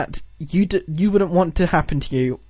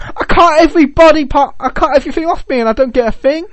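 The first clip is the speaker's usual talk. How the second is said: high, loud indignant complaint; mock contestant; 8 semitones higher, 4 dB louder than usual. The runner-up protesting he got nothing, mock indignant. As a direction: whine like the cheated runner-up.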